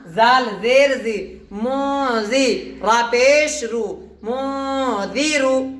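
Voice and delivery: مو زی رو read very slowly, the nun hidden in the nose; nasal ikhfa reading. Shown as a demonstration. Teacher reciting slowly for students, nasal ikhfa. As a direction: stretch each syllable of مو زی رو with nasal hum. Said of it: "تو اس میں کیا کریں گے اب؟".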